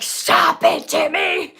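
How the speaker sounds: very loud mock yell of a small kid copying mom; hammed-up, blaring little-kid yell; magnified toddler scolding. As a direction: blow the scolding up into an exaggerated toddler shout. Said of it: "Elijah will be like".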